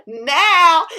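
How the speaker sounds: very loud, high yell of "now"; full shout. A narrator's reading. Fox startles the sleeping bear, full-force shout. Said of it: "He shouted".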